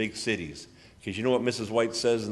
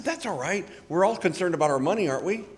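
cheerful reassurance; 8 semitones higher than usual. Retold or imitated. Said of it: "I said".

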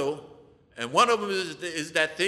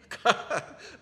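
short breathy laugh; 6 semitones higher than usual. Real, not acted. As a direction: break off into a laugh. Laughs while stumbling over the word.

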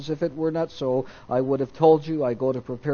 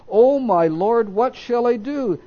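1 frightened servant; 7 semitones higher, 4 dB louder than usual. Acted. Frightened servant sees the army.

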